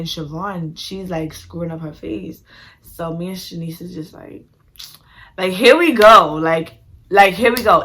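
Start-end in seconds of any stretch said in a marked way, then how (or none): 5.4-7.8 loud, exasperated groaning complaint. Retold or imitated. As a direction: complain loudly, fed up already. Exasperated at cousin's scowling.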